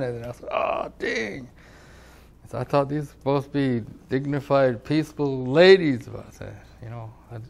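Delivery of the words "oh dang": high, put-on pained "Oh, dang"; a schoolboy hit on the hand by the nun; boyish yelp. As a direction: squeak it like a kid just slapped. Reaction to ruler slap.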